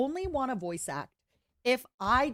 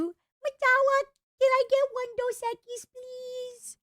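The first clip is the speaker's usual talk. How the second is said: much higher squeaky voice. Pretend. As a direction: squeak the order. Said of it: "Can you do".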